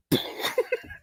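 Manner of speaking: breathless falsetto titter; mock giggle. Bill Burr's brunch lady, tittering in breathless falsetto. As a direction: giggle high and airy. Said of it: "He's like".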